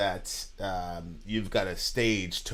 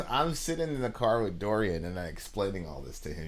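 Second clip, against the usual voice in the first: ordinary delivery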